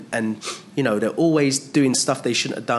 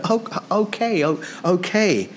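high, mock-bewildered okay; Pilate resigned; 8 semitones higher than usual. Pilate gives in to the crowd, high and mock-surprised. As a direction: play it as a lightly voiced, taken-aback governor. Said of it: "you can almost hear Pilate saying".

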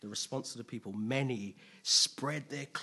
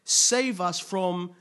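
higher, louder rallying cry, slowing into a plea; crowd crying out; 5 semitones higher, 5 dB louder than usual. Acted. Crowd hailing a king against Roman oppressors, crying out. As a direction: call out like a crowd hailing its rescuer. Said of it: "So they're like".

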